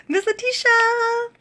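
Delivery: high, loud excited call-out to her coach. Real, not acted. Vlogger greeting her coach, excited call. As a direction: sing out her name with delight.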